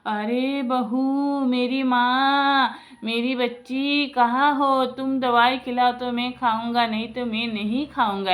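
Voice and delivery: slow, drawn-out high wheedling call; acted-out old patient pleading for care; pleading sick father-in-law. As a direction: call out to her imploringly, stretching each word. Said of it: "ससुर जी क्या बोलते थे".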